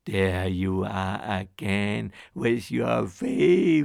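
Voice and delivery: slow, loud teasing scold; mock his old refrain; scolding wife. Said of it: "the ogre's wife said".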